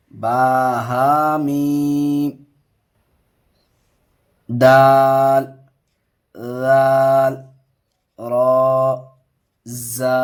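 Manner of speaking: drawn-out, sing-song reading of letter names; slow letter recitation. Shown as a demonstration. Teacher reading a Qaida lesson aloud, slow sing-song recitation. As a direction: chant each letter slowly for the pupil.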